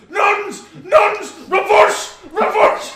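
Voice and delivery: loud, high shouted Irish commands. Retold or imitated. Jack's wheelchair scene retold, loud, high Irish shouting. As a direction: bellow each word in a comic Irish voice. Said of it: "she goes".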